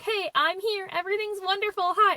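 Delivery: high, sing-out mock greeting; exaggeratedly carefree high-pitched hello; breezy arrival. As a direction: imitate an oblivious friend sailing in cheerfully. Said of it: "my friend comes strolling in like".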